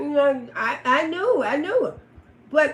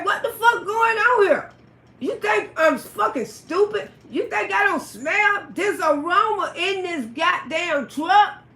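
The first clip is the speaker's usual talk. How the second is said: angry yell; 4 semitones higher, slower than usual. Retold or imitated. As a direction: lay into them. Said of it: "I say".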